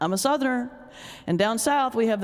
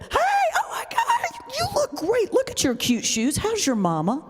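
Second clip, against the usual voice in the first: gushing Southern greeting; 13 semitones higher than usual. Shown as a demonstration. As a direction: gush over a friend you just spotted. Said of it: "you reel it all together and you get".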